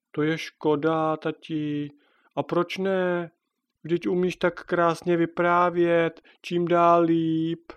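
a daughter coaxing her dad; child's voice. Acted. Daughter Natálka praises father.

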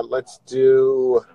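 usual speaking voice

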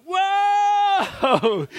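high yelp of surprise; startled whoa. Retold or imitated. Climber tipped off the cliff yelps whoa in a high startled voice. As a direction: cry out as you drop.

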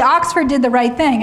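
ordinary delivery

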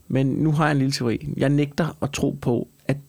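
ordinary talk

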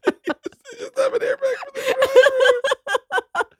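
squeaking the words out through laughter; laughing. Real, not acted. Friend repeating the airbag punchline, laughing hard.

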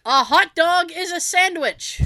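loud, slow provocative statement; hammed-up bold claim; mock proclamation. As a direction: announce it as a declaration.

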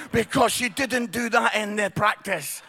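normal talking voice

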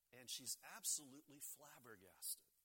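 usual speaking voice

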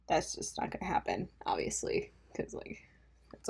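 soft unvoiced whisper, mocking herself; whispered aside. Real, not acted. Podcaster whispering a self-mocking aside to listeners. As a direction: hush it like a secret joke.

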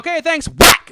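mocking brush-off. Brushing off the neighbor at the door, high mocking squeal. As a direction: squeal it out like a rude cartoon brat. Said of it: "I was like".

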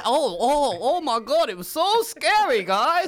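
high, loud Boston whine; Boston accent. Retold or imitated. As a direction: squeal it in a hammy Boston accent. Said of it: "almost as good as Anissa's Boston accent".

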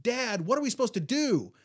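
high, loud panicked call to dad; a boy begging his father for help, voice jumping up; panicked plea. Retold or imitated. Boy at the boat ramp calling to his dad, high and panicked. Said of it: "I was just in this panic".

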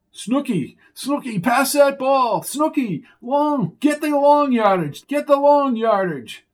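high, loud yelling from the sideline; coach shouting. Acted. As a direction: bellow orders at the players. Hallucinating coach yelling at game.

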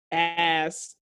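ordinary delivery

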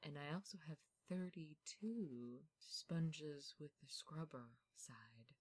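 slow, soft creepy butler voice; spooky butler. Acted. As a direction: hush it like a eerie butler showing his house. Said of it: "sounds like a spooky butler".

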